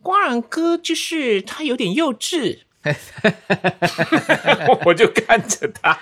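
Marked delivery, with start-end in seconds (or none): 0.0-2.6 high, slow teasing voice; mock partner's voice. A partner teasing Guang Liang as childish, high and slow. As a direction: drag it out playfully. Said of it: "他还说".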